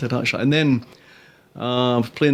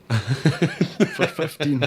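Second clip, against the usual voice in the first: laughing; 3 semitones higher, 3 dB quieter, much slower than usual. Real, not acted. Joke punchline laughing.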